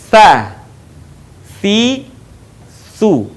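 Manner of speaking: tongue on upper teeth, gentle airflow on each s of "Sa, si, su"; soft lisped th. Shown as a demonstration. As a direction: say "Sa, si, su" one by one with breath flowing over the tongue tip. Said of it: "mengalirkan suara dan nafas". ("Sa, si, su" satu-satu, napas dialirkan lembut)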